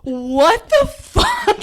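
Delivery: very high, drawn-out and loud; laughing disbelief. Retold or imitated. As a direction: stretch it out incredulously until laughter takes over. Retold disbelief, breaking into laughter.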